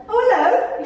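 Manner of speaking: high, sweet mock-innocent hello; lightly sing-song greeting. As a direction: play it falsely innocent. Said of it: "I looked up and went".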